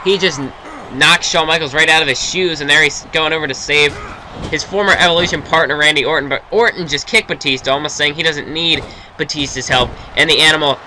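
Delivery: wrestling commentator calling the match; steady ringside play-by-play; play-by-play commentary. Acted. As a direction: call the action like a TV announcer.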